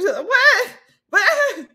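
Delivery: high, loud pretend yelps; mock startled cries. Acting out somebody woken by a squeeze, mock startled. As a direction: yelp as if jolted awake. Acts out being squeezed awake.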